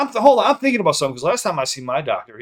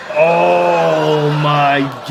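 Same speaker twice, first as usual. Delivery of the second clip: long, loud, drawn-out groan of shock; stunned, very slow exclamation; loud disbelief; 4 semitones higher, 10 dB louder, much slower than usual. Real, not acted. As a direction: stretch it out, loud and higher, in disbelief. Stunned reaction to played comedy bit.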